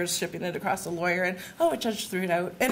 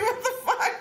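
laughing disbelief; 13 semitones higher than usual. Retold or imitated. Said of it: "Like, I'm like".

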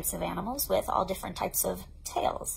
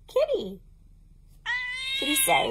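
much higher, delighted reveal; higher delighted kitty; excited; 4 dB louder than usual. Acted. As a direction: gasp it out for toddlers. Teacher introducing kitty.

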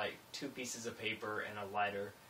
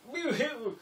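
high, loud sarcastic woo-hoo; mock cheer; 6 dB louder than usual. Mocking the cops with a high sarcastic cheer. Cheering while told to put out fire.

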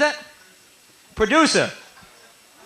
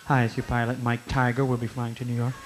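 lower, softer captain-on-the-intercom voice; pilot voice; 8 semitones lower, 8 dB quieter than usual. Retold or imitated. Comic mimics pilot over the plane speaker in low voice. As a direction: do the calm pilot announcing the flight.

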